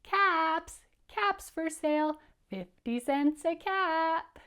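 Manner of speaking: high, sustained singsong cry; street vendor's call. Shown as a demonstration. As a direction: call out your wares to the town. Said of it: "Can you do that whole sentence one more time?".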